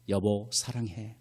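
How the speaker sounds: low, slow, soft husband's voice; tender affection. Pretend. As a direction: act a loving husband, softly and slowly. Imagined loving husband on phone. (다정하고 나직한 남편 흉내)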